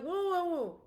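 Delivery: high-pitched, acted interruption; startled protest. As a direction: cut in with a mock-alarmed objection. Acts out cutting son off.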